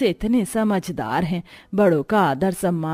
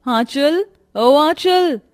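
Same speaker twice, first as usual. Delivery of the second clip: calling out; 6 semitones higher, 4 dB louder, much slower than usual. Acted. Mistress calling out for maid.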